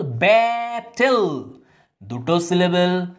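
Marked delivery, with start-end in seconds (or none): 0.0-1.4 battle stretched slowly over two syllables; broken into syllables. Shown as a demonstration. Teacher splitting the word for students, battle slowly syllable by syllable. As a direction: draw out both syllables of battle. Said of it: "যদি আমরা সিলেবেলে ভাঙি".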